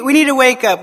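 usual speaking voice

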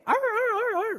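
high mock dog yelps; dog yelping. Preacher imitates the dog that got hit, high yelps. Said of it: "the dog that barks the loudest".

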